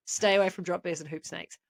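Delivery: usual speaking voice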